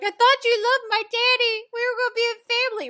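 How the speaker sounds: put-on high loud whiny child voice, rushing at the end; upset child. Snow upset at Regina, mock high whiny child voice. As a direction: whine like a betrayed little girl. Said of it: "She's like".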